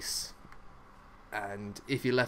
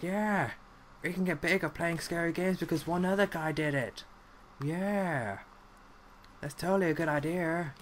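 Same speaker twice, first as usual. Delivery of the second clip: higher, overeager mocking voice; mock eager gamers; 6 semitones higher than usual. Everyone copying the scary-game trend, in a mock eager voice. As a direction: parody their naive enthusiasm. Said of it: "everyone was like".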